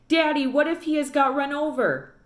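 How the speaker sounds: put-on a frightened girl begging her father; worried child. A narrator's reading. Girl asks father if dog was run over, anxious and high.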